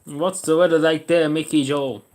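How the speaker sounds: loud and slow, hailing someone far off; calling out. Pretend. Streamer calls out to Mickey Joe, loud slow hail. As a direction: call it out like you're summoning the weatherman.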